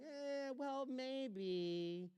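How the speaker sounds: high, wavering, unsure hedge; timid non-answer to a church member; insecure hedging. Pretend. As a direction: sound like a leader who can't decide. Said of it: "I'm being insecure".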